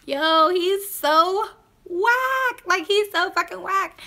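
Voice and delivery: high, drawn-out mock of him; laughing disbelief. Thinking the date is lame, laughing disbelief. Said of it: "I was just like".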